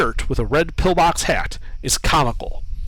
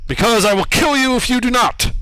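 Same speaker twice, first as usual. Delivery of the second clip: booming statue voice; 8 semitones higher, 4 dB louder than usual. Acted. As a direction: lord it over them, booming.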